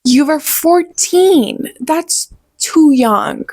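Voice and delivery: put-on drawn-out scoff at the book's teens; exasperated retort. Reviewer scoffing at teen characters, mock exasperated. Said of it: "I'm like".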